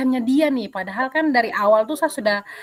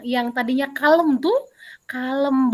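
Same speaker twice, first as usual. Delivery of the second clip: ordinary talk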